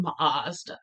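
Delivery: "Mazda" stretched with an open "ah"; rejected pronunciation. Shown as a demonstration. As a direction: say "Mazda" as a drawn-out "MAHZ-da". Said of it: "Nobody is saying".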